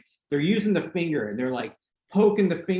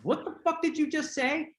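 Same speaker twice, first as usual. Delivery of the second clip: incredulous retort; 11 semitones higher, 5 dB quieter than usual. Retold or imitated. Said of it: "he looks at me and he's like".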